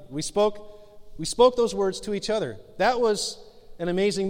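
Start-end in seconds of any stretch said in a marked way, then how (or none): none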